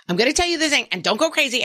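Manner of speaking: high, fast singsong warning; a lightly voiced nagging parent; mock warning parent. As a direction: rattle it off like a nagging mom. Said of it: "I'm always like".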